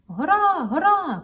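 high loud excited shout. Acted. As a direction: shout out the mountain. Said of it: "кричить причіп". (радісний крик)

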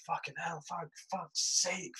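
a shaken soldier cursing under his breath; muttered swearing. Retold or imitated. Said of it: "we've got one guy just like".

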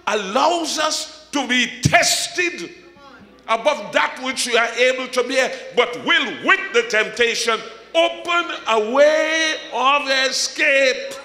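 high, drawn-out pulpit cry; full-force preaching. Real, not acted. Preacher promising believers a way out, full-force preaching. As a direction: ring each phrase out to the congregation.

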